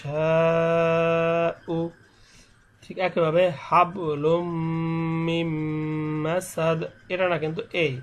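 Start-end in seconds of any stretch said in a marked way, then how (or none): 0.1-1.8 "মাইয়াশাউ" very slow, chanted on a level note with a nasal hum; ghunnah recitation. Shown as a demonstration. Teacher showing how ghunnah sounds, slow nasal chant. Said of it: "গুণনা হবে এরকম". 3.7-6.8 "হাবলুম মিম মাসাদ" slow, each joined m held as a hum; ghunnah recitation. Shown as a demonstration. Teacher showing the same rule, slow nasal hum. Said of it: "গুণনা হবে এরকম".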